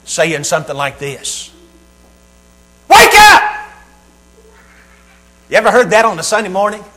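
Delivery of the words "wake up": sudden booming high shout; mock sweet wake-up yell. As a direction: blast the family awake, far from sweet. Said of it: "the kind, sweet, polite voice".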